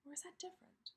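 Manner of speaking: breathy teacher whisper. Shown as a demonstration. Teacher whispering a question to the kids.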